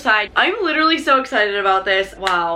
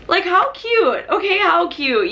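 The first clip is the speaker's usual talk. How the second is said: high, slow delighted gush; 8 semitones higher, slower than usual. Real, not acted. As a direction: coo over how cute it is. Gushing over the receipt envelope.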